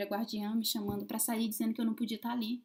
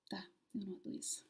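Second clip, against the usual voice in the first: softer, whispered reply; 8 dB quieter than usual. Retold or imitated. She answers the voice inside her, whispering. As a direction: hush it like a secret agreement.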